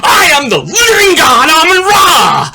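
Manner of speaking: high, loud, outraged proclamation; indignant roar. Acted. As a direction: thunder it back like an offended god. Shouting back at the yelling pressmen.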